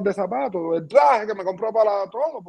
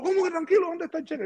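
imitating a much higher, frantic demand; mock furious; 10 semitones higher than usual. Agent bursting into the hotel room, demanding the cheque in mock fury. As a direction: play the agent storming in. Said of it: "lo que entró fue una fiera".

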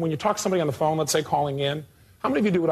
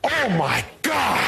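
shocked gasp; 4 semitones higher, much slower than usual. Retold or imitated. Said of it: "people in front row going".

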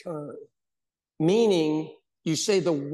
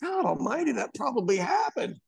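shocked realization; 7 semitones higher than usual. Retold or imitated.

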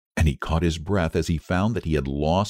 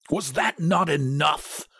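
slow, higher, fierce demand; 7 semitones higher, slower than usual. A narrator's reading. Husband rebukes wife over the first wish, fiercely. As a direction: demand it with mild reproach. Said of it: "he demanded fiercely".

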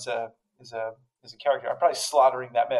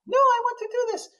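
imitating his kid.

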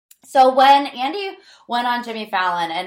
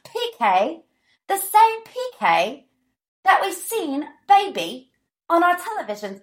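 bad Dorit accent; 8 semitones higher than usual. Podcaster marveling at the P K on television, bad Dorit accent. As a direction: ham up a poor British accent. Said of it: "That was a horrible Dorit accent".

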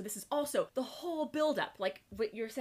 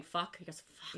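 low, soft growled curse; a hint of a growl; mock growl; 6 semitones lower, 10 dB quieter than usual. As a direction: breathe it out low and rough. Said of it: "He does a lot of growling".

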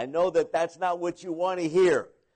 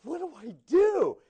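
mock panic; 8 semitones higher than usual. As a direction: wail the question like you're truly stuck. Panicked new missionary, final take.

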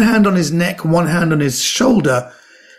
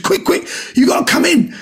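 high, urgent hurry-up call; 11 semitones higher than usual. Retold or imitated. Manager rushing Omid to the party, high urgent call. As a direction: hurry them in, high and breathless.

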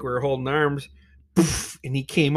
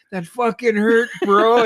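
high, raised-voice protest; pained complaint; 7 semitones higher, 6 dB louder than usual. Retold or imitated. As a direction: imitate yourself complaining through the sting. Retold complaint after belly flop.

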